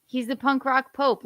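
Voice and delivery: normal talking voice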